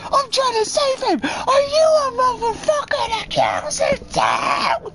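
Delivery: the woman screams at the Walmart employee; mock shrieking zealot. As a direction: shriek it at the store clerk. Woman yelling at store worker.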